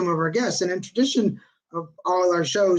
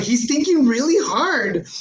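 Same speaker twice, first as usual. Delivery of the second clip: mock thoughtful; 7 semitones higher, 4 dB louder, slower than usual. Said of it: "I was like".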